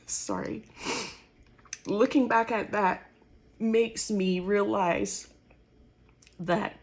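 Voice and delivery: fighting back tears while pushing the words out; choked up, emotional. Real, not acted. Testifying about her purpose, voice rising with real emotion. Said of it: "forgive me that I'm getting a little emotional".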